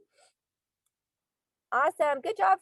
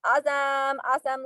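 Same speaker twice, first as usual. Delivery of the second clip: normal talking voice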